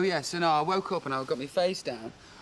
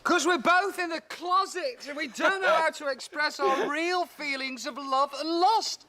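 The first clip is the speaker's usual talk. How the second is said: high, mocking voice, slowing at the end; hammed-up confession of love and lust, pitched way up; high teasing mock-romantic voice; mock camp confession; 13 semitones higher, 3 dB louder than usual.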